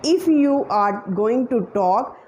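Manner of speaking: normal talking voice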